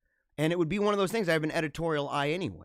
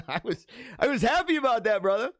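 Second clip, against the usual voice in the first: higher voice breaking into a laugh; laughing delight; 6 semitones higher, 4 dB louder than usual. Real, not acted. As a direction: say it through a happy laugh. Laughs over his own delight.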